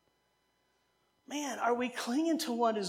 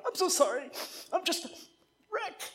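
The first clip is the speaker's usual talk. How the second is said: high-pitched, put-on tearful apology; mock crying; 8 semitones higher than usual. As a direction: act out a weepy breakdown, pitch climbing. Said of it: "started crying and going".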